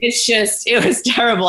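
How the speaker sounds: laughing while talking; laughing, a bit high-pitched. Real, not acted. Laughing at her own story.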